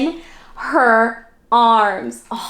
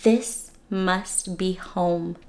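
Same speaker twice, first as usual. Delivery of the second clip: softer, slow tender bear; 4 semitones lower, 6 dB quieter, much slower than usual. Acted. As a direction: say it gently, full of wonder. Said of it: "he said".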